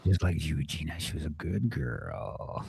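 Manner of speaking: slow, low, leering murmur; creepy low voice. Acted. Teasing a friend in a slow creepy croon.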